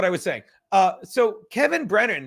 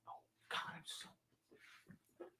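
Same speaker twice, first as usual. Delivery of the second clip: whisper; 29 dB quieter, much slower than usual. Real, not acted. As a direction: drop to a breathy whisper mid-sentence. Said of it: "Sorry, I'm whispering".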